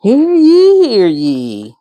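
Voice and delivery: mock royal proclamation; town crier call. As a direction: announce it like a herald.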